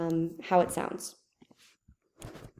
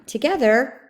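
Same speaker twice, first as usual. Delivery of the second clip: Together, said much higher and louder, then a clear stop; comma pause stressed; 6 semitones higher, 6 dB louder than usual. Shown as a demonstration. Teacher demonstrating the audible comma to students, pausing after Together. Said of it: "I hear the comma".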